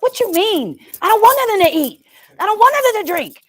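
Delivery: put-on high-pitched, quick childish complaint; whiny protest. Acting out own childish protest.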